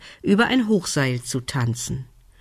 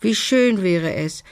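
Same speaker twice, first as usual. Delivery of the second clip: plain reading voice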